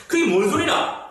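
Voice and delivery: a mock-angry Gyeongsang line; Gyeongsang dialect. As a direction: scold in Gyeongsang dialect, a bit high. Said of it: "막 화를 내고 있습니다".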